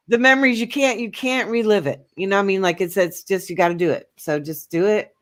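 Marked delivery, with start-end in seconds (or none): none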